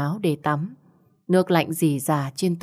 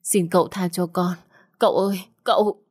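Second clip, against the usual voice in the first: tearful pleading rising to a desperate cry, quicker at the end; begging in tears; 2 semitones higher than usual. Acted. Wife pleading with her husband to stop beating her, tearful. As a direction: beg for mercy through the tears. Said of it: "van xin trong nước mắt".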